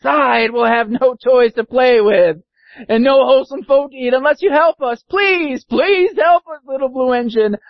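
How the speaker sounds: high, beseeching cry; desperate high-pitched plea; pleading dolls and toys. Acted. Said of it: "cried the dolls and the toys".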